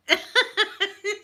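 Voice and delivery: laugh. Real, not acted. Laughing about Lena's reaction.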